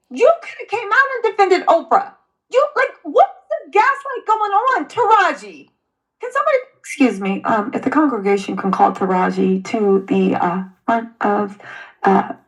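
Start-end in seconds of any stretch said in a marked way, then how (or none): 6.2-12.3 low-pitched, halting congregation announcement; churchy announcement with mock formality; mock church announcer. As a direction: address the congregation like a church official, low and hesitant.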